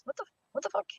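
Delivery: retold shock, pitched high and hushed; shocked disbelief. Retelling shock at daughter's red face.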